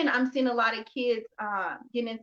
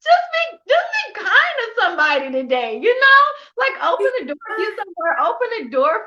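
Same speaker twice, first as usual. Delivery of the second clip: high, loud plea through laughter; real laughing outcry, pitched way up; exuberant shout; 10 semitones higher, 9 dB louder than usual. Real, not acted. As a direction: burst out laughing and exhort them loudly. Exuberant plea bursting out after laugh.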